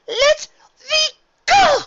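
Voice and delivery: high, loud desperate cry to be freed; desperate scream. Acted. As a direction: scream it, fighting to break loose. Trapped, fighting to escape.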